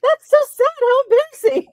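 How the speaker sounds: high, loud giggly sympathy; laughing mock pity. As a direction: pretend sorrow while laughing. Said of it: "I'm like".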